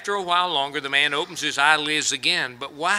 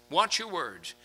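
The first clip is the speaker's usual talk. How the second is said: soft echo; 10 dB quieter than usual. Acted. Repeated softly after emphatic take.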